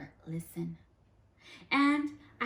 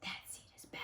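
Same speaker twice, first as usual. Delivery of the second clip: very soft, breathy gossiping whisper; 18 dB quieter, faster than usual. Acted. Other seeds gossiping about the bad seed, whispering. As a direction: whisper it behind his back. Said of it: "they say".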